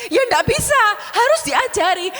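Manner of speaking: hammed-up stubborn old man; high insistent elder. Pak Roni insists during conflict.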